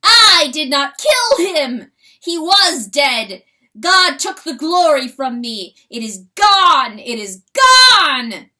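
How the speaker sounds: very loud, slow, anguished crying out; crow of agony. Acted. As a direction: wail it in shattered grief. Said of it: "a crow of shattering agony".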